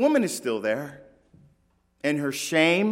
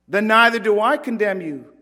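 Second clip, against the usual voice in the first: firm declaration; 4 semitones higher, 6 dB louder than usual. Retold or imitated. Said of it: "Jesus declared".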